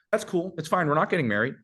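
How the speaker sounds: high, easy shrug-it-off voice; acted nonchalance. Man replying to his date's differing values, high-pitched nonchalance. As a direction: wave it away, pitched well up. Modelling an unbothered reply on date.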